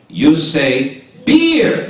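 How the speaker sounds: slow, clear beer for one; pronouncing beer. Shown as a demonstration. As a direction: say beer slowly as BEER. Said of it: "to say one in Turkish".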